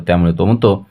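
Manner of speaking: usual speaking voice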